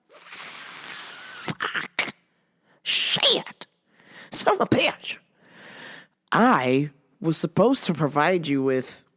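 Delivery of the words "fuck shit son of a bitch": voiceless, hissed cursing; hammed-up whispered swearing. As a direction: curse under your breath, exaggerated. Mock swearing at own failure.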